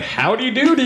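high, hammed-up American cowboy accent from a Brit; mock a Texan greeting badly; Brit doing a cowboy accent. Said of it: "He's like".

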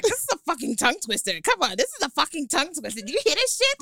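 whiny retelling of his gripe; mock complaint. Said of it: "he's like".

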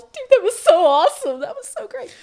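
very high, squealing excitement; giddy little girls. Retold or imitated. Actresses coming offstage thrilled, imitated little-girl squeal. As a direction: gush like an overexcited little girl, loud then tailing off. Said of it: "we were like little girls".

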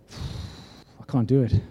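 big exhale, then low and soft; hushed, defeated mock admission; retold dread. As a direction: act out giving up, slowly and quietly. Retold fear at the bank.

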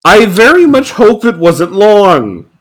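high incredulous outrage. Real, not acted. Mocking the cheap costumes.

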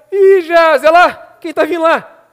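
loud, high call of surprise; excited acted shout from the mountaintop; prophet calling out. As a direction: call to your servant about a distant figure. Prophet spots woman from afar.

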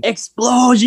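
high-pitched mock yell; one word shouted way up high; imitated yell. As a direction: imitate an excited kid yelling it out. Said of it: "He's just yelling".

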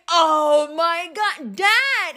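drawn-out retold exasperation, rising on "Dad"; long groaning "oh my God"; exasperated. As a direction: scold a parent in mock dismay. Exasperated reaction to dad's confession.

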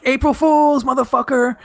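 high, crowing gotcha; spring the joke on him triumphantly; gleeful prank reveal. Retold or imitated. Prankster reveals the April Fool's joke to his friend, high crowing taunt.

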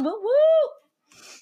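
high, loud cheering woo-woo; high-pitched hype cheer; celebratory whoop. Real, not acted. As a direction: whoop to welcome the guests. Cheering the guests' welcome.

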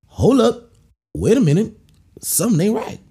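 something's wrong, stop everything; alarmed realization. Pretend. Noticing the tampon in his teeth, alarmed.

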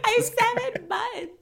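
high, slow mock-baby whine; crying baby. Aunt voicing the crying baby nephew, high mock whimper. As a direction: whine it slowly as a pitiful infant. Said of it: "my nephew cries".

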